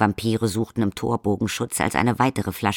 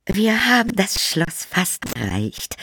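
high, slow peacemaking countess; smooth things over gently; soothing countess; 10 semitones higher, slower than usual. Acted. Countess calms her quarrelling family, slowly. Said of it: "in dem Versuch, den familiären Frieden wiederherzustellen".

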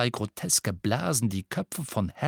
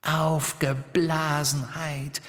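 slow, higher taunting echo; mocking echo; 5 semitones higher, much slower than usual. Riddle-Hermine echoing the insult to Ron, slow mocking. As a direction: drag the word out mockingly. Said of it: "wiederholte die Riddle-Hermine".

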